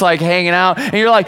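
usual speaking voice

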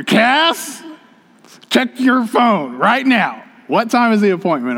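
high, slow, acted-out demand; mock-irate call to your wife; hammed-up exasperation, each word drawn out; exasperated husband.

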